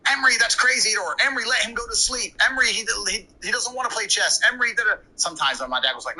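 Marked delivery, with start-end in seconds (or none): 0.0-5.0 much higher pleading imitation of mother; mother's voice pitched high, exasperated; mom pleading. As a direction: whine at the husband like his mom. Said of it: "my mother trying to be like".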